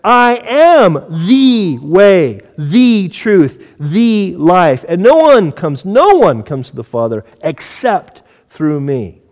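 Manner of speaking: slow, loud stress on "am" and each "the", "no one" punched; emphatic reading. Shown as a demonstration. Preacher showing how Jesus' exclusive statement should be read, emphatic. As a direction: stretch "I am THE way", weight on every "the". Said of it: "this should be read".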